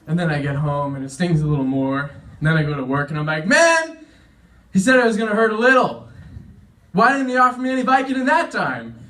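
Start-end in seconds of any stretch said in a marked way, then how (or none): 3.5-9.0 high, exasperated whine of protest; mock outrage at being short-changed; aggrieved complaint. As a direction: complain loudly, pitched up. Griping after the treatment stings.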